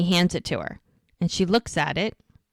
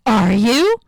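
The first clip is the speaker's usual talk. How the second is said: loud, higher question leaping up at the end; eager surprise; 4 semitones higher, 7 dB louder than usual. Retold or imitated. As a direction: act out an astonished, delighted question. Retold surprise at pregnancy news.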